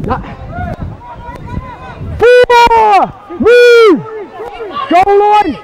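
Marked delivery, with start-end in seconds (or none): 2.2-5.5 shouted calls. Real, not acted. Referee calling over the play.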